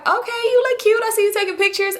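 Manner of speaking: high-pitched auntie impersonation; put-on high voice for a retold greeting; imitating auntie. Acts out her aunt's greeting.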